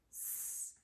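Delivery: a voiceless hissed "s" with no vowel after it; just the hiss of "s", like air from a tire; hissed s sound. Shown as a demonstration. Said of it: "I hear the suffix S".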